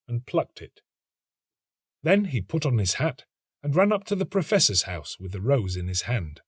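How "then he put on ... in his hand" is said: plain reading voice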